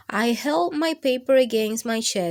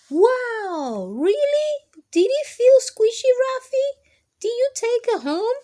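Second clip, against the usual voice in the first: much higher, loud, fast eager questions; excited kid; 14 semitones higher, 8 dB louder than usual. Acted. Daisy to Ralph, high fast excited questions. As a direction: gush like a thrilled classmate.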